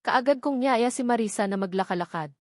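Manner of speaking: plain reading voice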